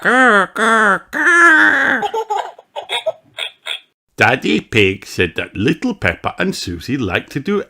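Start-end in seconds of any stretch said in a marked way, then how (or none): 0.0-2.0 high, louder playful growl; a child playing dinosaur.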